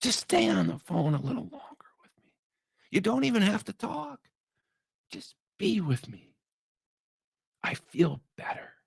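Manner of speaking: soft, hushed plea to his wife; pleading whisper. Retold or imitated. Husband to wife on the phone, hushed pleading whisper. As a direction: whisper it, begging her to stay on.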